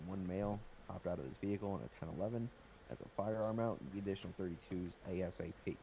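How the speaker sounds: usual speaking voice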